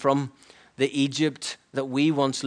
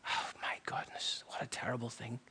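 near-whispered, appalled aside; hushed dismay; 14 dB quieter than usual. Real, not acted. Preacher confessing his old attitude, hushed and appalled. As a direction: drop to a whisper in regret.

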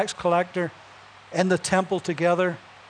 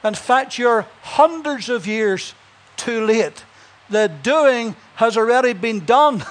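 slow, higher, emphatic preacher's reply; Moody's answer; 4 semitones higher, 3 dB louder, slower than usual. Acted. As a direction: deliver it like a triumphant verdict. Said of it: "Moody said".

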